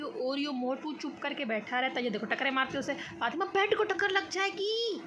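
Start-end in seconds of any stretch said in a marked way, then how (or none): 3.4-4.9 higher, far-off sing-song voice for the cat; sing-song pet talk. Real, not acted. Owner warning her cat about the bed, cooing.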